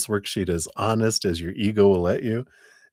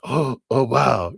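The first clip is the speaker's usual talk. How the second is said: gasp of realization; 6 semitones higher, 3 dB louder than usual. Retold or imitated. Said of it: "and it's like".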